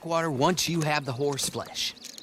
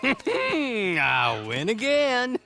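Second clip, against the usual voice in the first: laughing; 6 semitones higher, much slower than usual. Acted. Clay greets the stranger, laughing.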